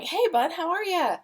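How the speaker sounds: greeting the grown son, voice lifted high; high fond greeting. Retold or imitated. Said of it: "my voice goes up".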